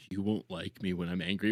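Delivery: mock tough guy quote. Streamer repeating the quote in a low mocking voice. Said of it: "That's what he basically said".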